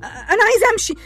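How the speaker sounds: high, rising, crying protests; tearful denial. Acted. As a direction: refuses the truth, begging to go home. Denies diagnosis, wants to leave.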